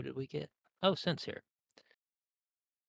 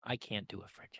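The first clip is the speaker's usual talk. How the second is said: whispered aside; 3 semitones lower, 9 dB quieter than usual. Real, not acted. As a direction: mutter it off-mic, admitting defeat. Rueful aside mid NPC voice.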